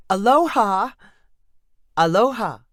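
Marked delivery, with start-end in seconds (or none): 0.1-0.9 higher, louder bright hello; cheerful greeting. Acted. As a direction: greet eagerly in Hawaiian. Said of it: "Dawn greeted the woman".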